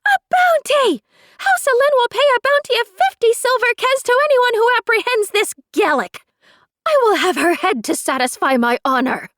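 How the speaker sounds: high, loud, fast furious cry; furious shriek. A narrator's reading. Neris offering a bounty to the crowd, furious shriek. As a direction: scream the bounty to the whole crowd. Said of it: "Neris shrieked from behind".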